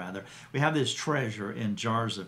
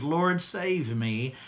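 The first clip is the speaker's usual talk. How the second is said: slow, drawn-out plea for rescue, a touch raised; crying out; 5 semitones higher, 3 dB louder, much slower than usual. Retold or imitated. Sinking Peter crying out to Jesus, slow pleading cry. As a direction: stretch each word like a man going under. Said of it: "when he cried out".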